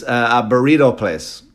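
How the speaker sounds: usual speaking voice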